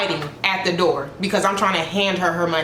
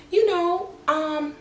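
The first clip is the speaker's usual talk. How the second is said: pitched-up mock client, rising; imitating client; 11 semitones higher, slower than usual. Client questioning the stylist, high mock voice. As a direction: play her innocent and high.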